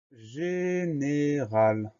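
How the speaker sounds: very slow, syllable by syllable; drawn-out dictation. Shown as a demonstration. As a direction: stretch every syllable for pupils writing it down. Dictée, mot annoncé lentement. (dictée syllabe par syllabe, très lente)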